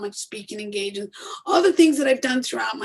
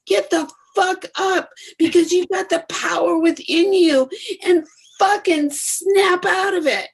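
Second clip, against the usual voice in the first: higher, louder, fierce rallying cry; passionate yell; 8 semitones higher, 8 dB louder than usual. Real, not acted. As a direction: shake them awake with fire. Fired-up motivational pep talk.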